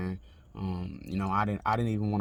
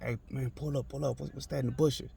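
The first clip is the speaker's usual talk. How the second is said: retold urgent request; 5 semitones higher than usual. Telling the lady to pull up, urgent but restrained. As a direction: quote an urgent call in a held-back voice.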